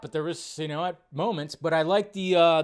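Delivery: ordinary talk, nothing marked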